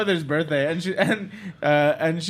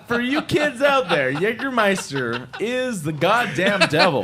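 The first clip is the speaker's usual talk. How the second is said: mock warning; 6 semitones higher, 3 dB louder, slower than usual. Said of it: "mock PSA".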